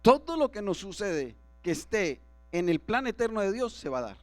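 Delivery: ordinary talk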